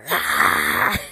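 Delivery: loud, low frustrated yell; frustrated scream. Retold or imitated. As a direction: let it all out in one blast. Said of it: "And I was like".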